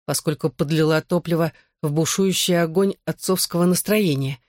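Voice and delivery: plain reading voice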